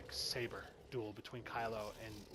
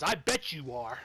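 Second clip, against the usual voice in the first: mocking Kylo's line; 5 dB louder than usual.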